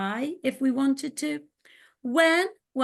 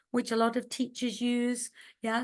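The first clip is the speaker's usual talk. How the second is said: normal talking voice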